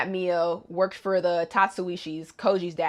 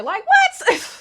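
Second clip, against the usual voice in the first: disbelief; 6 dB louder than usual. Real, not acted. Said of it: "reacts in disbelief".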